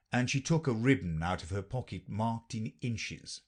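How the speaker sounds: ordinary delivery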